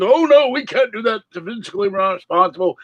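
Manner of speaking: high, fast prissy refusal; mocking Republicans. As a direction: whine it like fussy senators. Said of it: "but the Republicans".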